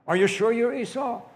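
high, loud, doubting challenge; insistent questioning. Acted. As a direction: demand to know who this really is. Father questions him yet again.

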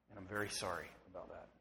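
low, very soft, quickened sorry; hushed apology. Real, not acted. Pastor apologizing to those hurt by parents, hushed. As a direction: breathe it out tenderly.

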